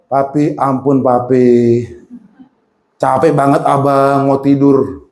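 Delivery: low, worn-out child's whine, imitated; tired children pleading. Children beg father to stop the lessons, imitated tired pleading. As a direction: plead like an exhausted kid begging for bed. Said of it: "Mereka kan capek".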